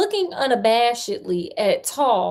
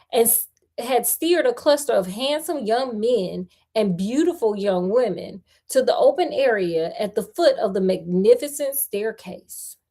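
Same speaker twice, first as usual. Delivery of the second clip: usual speaking voice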